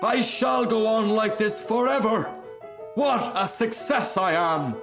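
high, triumphant self-praise; exultant cry. A narrator's reading. Rocket soaring through the air, high exultant cry. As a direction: crow over your own success. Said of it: "he cried".

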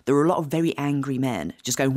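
ordinary delivery

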